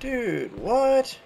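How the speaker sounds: sudden high "Dude, what?" in real surprise; startled disbelief; surprised. Real, not acted. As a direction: caught off guard, voice jumping up.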